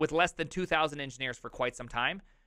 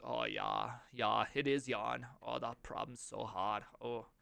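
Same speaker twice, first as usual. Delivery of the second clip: low, soft, badly faked German accent; 5 semitones lower, 9 dB quieter, slower than usual. Streamer imagines Jan complaining, in a bad German accent. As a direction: sigh it in a hammy German accent. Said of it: "That's me doing a German accent".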